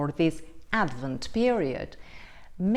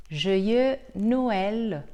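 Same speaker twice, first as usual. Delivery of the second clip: "Joyeux Noël" in native French, a little louder; French accent; 4 dB louder than usual. Real, not acted. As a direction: greet warmly in French. Christmas greeting in French.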